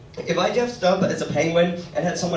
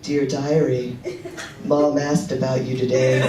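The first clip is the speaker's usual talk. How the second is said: mock diary reading; 2 semitones lower, slower than usual. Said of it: "follow her around Walmart going".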